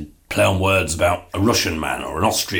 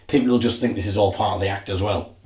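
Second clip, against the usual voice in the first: usual speaking voice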